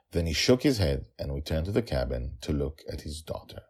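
plain reading voice

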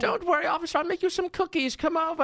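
lightly voiced old granny; sweet grandma offering cookies; old lady voice. Mocking grandma voice.

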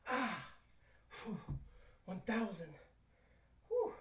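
panting between squats; breathless workout talk; out of breath. Real, not acted. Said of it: "I'm out of breath".

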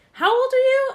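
high, loud mock clerk asking her age; suspicious clerk. Clerk doubting her ID, mock suspicious question. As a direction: play the doubtful cashier.

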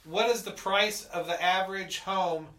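loud command. Real, not acted. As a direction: call out clearly to the device. Repeating the failed voice command.

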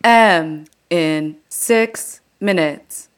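slow automated transit announcer with long pauses; Muni announcement voice. Acted. Comic doing Muni impression for co-host, automated announcer voice. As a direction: read out arrivals word by word, evenly spaced. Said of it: "You got the pauses".

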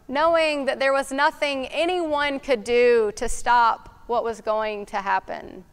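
ordinary talk

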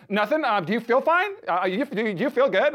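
high, loud, flustered questions; mock freaking out. Technician checks on the running patient, freaking out. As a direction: imitate a technician badly hiding alarm. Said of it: "she's, like, kind of freaking out".

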